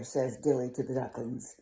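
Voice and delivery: plain reading voice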